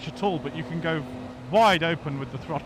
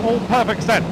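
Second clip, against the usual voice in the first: loud sarcastic echo; mock agreement, louder and higher; 5 semitones higher, 8 dB louder than usual. Real, not acted.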